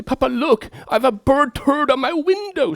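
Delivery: put-on high, insistent child voice; daughter's voice. Daughter shows her father the window, high childlike. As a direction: play the little girl pointing it out. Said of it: "she says".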